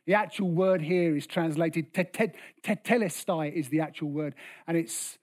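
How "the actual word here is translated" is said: ordinary talk, nothing marked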